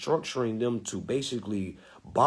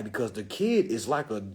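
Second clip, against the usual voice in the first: ordinary talk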